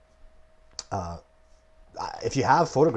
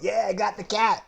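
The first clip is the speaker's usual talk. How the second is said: high, gloating cheer; mock boast; 12 semitones higher, 5 dB louder than usual. The mouse bragging to the cat, mock triumph. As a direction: crow like the mouse who thinks he won.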